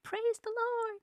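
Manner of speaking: high-pitched praise at normal volume; retold rejoicing. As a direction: exclaim it high, as a thankful quote. Acting out trainer's joyful reaction.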